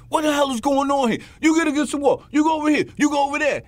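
imitating a cop barking orders on arrival; aggressive cop yell. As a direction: storm in shouting commands at the family. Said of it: "As opposed to coming there".

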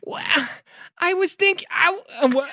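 very high, faltering falsetto; squeaky, hesitant put-on voice; attempted character voice. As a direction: try out a high voice, then falter. Game master attempts Janice's voice.

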